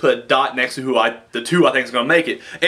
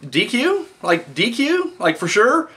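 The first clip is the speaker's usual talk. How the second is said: incredulous disbelief; 8 semitones higher, slower than usual. Retold or imitated. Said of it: "and I was like".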